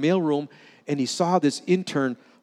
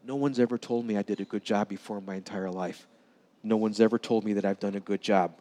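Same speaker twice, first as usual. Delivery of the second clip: softer, low, broken-hearted; quiet heartbroken admission; 5 semitones lower, 5 dB quieter than usual. Retold or imitated. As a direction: say it as if holding back tears. Intern tearfully confesses to senator.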